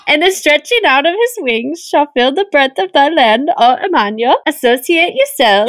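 lilting, botched Swedish-like reading; Swedish-sounding urchin accent. Acted. Co-host attempts an urchin reading, lilting Swedish-sounding accent. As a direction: swing the scripture up and down in fake Swedish. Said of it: "you're sounding more like Swedish".

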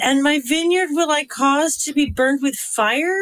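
put-on Valley girl uptalk, rising on "fire"; question at the end. Shown as a demonstration. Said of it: "question the end of that with a question mark".

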